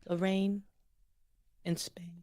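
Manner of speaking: quiet, halting American model; American accent. Shown as a demonstration. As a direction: demonstrate American English piece by piece. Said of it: "copy what you're saying".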